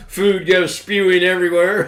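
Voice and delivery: laughing hard through words. Real, not acted. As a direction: crack up while talking. Laughing at friend spewing food.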